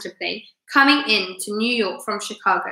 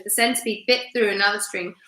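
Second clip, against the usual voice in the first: usual speaking voice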